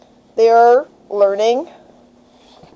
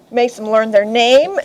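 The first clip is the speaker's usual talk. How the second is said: ordinary delivery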